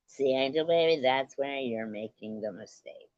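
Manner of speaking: normal talking voice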